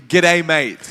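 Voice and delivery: Aussie accent greeting; laconic outback drawl. Shown as a demonstration. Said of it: "in Australia, we say".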